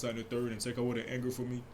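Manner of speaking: ordinary talk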